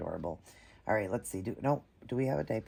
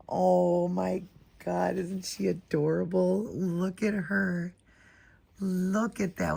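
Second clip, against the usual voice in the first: high, slow doting gush; adoring gush; 6 semitones higher, slower than usual. Real, not acted. Showing viewers the doll's face, high doting gush. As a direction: fawn over the sweet face.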